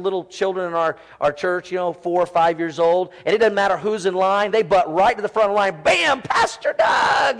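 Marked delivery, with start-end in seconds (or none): 5.8-7.4 put-on high, slow kid's shout of delight; excited kid greeting. Pastor imitating a small child cutting the greeting line with a slow excited shout. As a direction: mimic a little kid bursting through the line to the pastor. Little kids butting in line.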